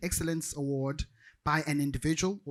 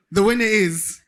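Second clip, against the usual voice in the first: loud awards-host reveal; big, raised-voice reveal over the audience; winner announcement; 9 dB louder than usual. Real, not acted. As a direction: announce the winner out to the whole hall.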